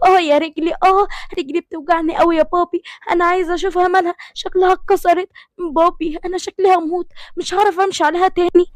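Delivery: high, hurting, whining to her father; high tearful complaint about the pain; pained whimper. Acted. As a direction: cry out over a hurt leg, pitched high. Girl hurt her leg.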